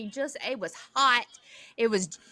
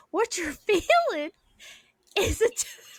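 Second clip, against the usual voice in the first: laughing dentist quote; 7 semitones higher than usual. Retold or imitated. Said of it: "He said".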